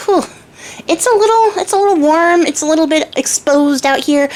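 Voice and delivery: high, breathless fairy fanning herself; flustered fairy. Acted. Fairy sitting naked, feeling warm.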